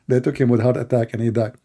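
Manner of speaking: usual speaking voice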